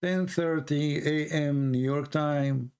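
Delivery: each word of "ten thirty A M New York time" drawn out slowly; slow, spelled out. Shown as a demonstration. Repeating the stream time slowly for viewers. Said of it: "I just said to you".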